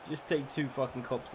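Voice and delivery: ordinary talk, nothing marked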